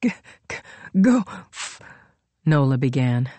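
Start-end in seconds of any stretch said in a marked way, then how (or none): 0.0-1.8 broken, breath-starved stutter; struggling stammer. A narrator's reading. Wounded Nola tries to curse Royal, struggling stammer. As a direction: choke on each first sound and fail to finish. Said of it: "though the words wouldn't come".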